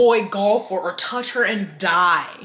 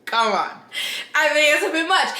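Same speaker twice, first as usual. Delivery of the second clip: incredulous; 7 semitones higher than usual. Real, not acted. Booktuber reacting to a lawsuit threat, incredulous. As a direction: go up high, half laughing at the absurdity. Incredulous aside after quote.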